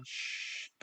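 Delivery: 쉬 as a long voiceless sh hush; sh hush sound. Shown as a demonstration. Teacher demonstrating the sh sound for the class, voiceless hush. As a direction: breathe out 쉬 with no voice, like hushing. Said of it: "오줌 누는 발음입니다".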